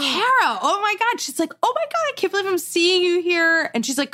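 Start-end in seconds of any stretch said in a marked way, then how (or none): 0.0-1.2 high-pitched delighted squeal of recognition; excited recognition. Retold or imitated. Excited surprise reunion with old friend. 1.6-3.7 imitating a thrilled friend, very high; excited reunion.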